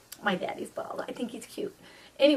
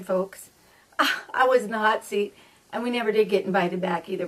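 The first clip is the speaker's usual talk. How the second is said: ordinary talk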